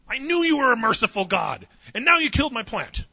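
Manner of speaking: loud, high aggrieved shout; furious whine. Retold or imitated. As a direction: yell it like a betrayed child. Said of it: "he accuses God".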